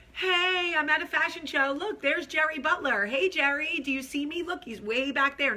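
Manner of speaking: high, excited mock self-filming voice; giddy video bragging. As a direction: imitate yourself showing off to the camera. Re-enacting her giddy funny video.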